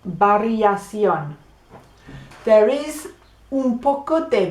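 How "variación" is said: Variación with a b, bariasion, slowly; drawn out, v said as b; slow Spanish b sound. Shown as a demonstration. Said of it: "practicar saying some B words".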